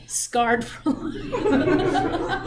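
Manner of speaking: drawn out through laughter; slow laughing delivery. Real, not acted. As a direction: laugh the words out slowly.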